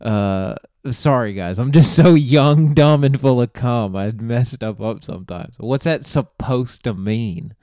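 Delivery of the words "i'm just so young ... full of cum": mock apology. Mocking the lame excuse.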